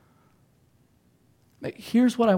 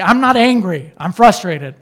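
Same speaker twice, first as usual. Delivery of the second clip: loud, hammed-up protest; mock angry denial; 10 dB louder than usual. Angry person in a fight answers the accuser, loud denial. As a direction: act out an indignant outburst while denying any anger.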